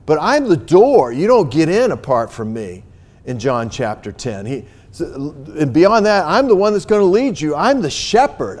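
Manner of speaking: loud, rising fervent sermon voice; preaching at full force. Acted. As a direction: proclaim it to the congregation, building.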